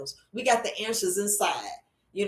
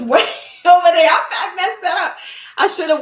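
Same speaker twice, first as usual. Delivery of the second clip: louder, higher, laughing admission; amused outburst; 8 semitones higher, 9 dB louder than usual. Real, not acted. Host who can't reach the comments, laughing at herself. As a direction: burst out laughing mid-sentence. Laughs at own computer setup mistake.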